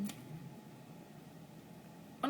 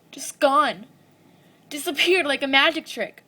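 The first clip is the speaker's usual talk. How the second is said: loud, emphatic disbelief; distressed outburst; 3 semitones higher, 10 dB louder than usual. Acted. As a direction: burst out louder, at a loss over her vanishing. Monologue about missing girl, distress rises.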